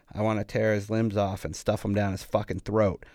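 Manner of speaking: plain reading voice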